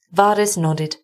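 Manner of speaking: usual speaking voice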